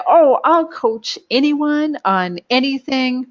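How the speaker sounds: slow, high, breezy overselling; overeager promise. Retold or imitated. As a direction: drawl it like you'll do anything. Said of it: "if we go out there and we say".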